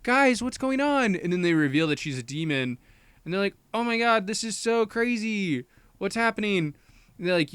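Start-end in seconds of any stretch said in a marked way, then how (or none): 0.0-1.2 high-pitched mock bewilderment; alarmed confusion. Singer questions her bandmates mid-show, mock alarmed. 3.7-6.7 put-on high-pitched freaking-out girls; mock shock. Girls learn their friend is a demon, mock shock.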